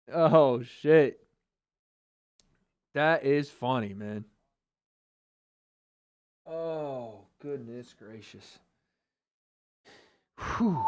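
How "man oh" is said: normal talking voice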